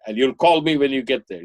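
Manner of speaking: mother extracting promises. Mother to son before he leaves, firm demand. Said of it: "she extracts all kinds of promises".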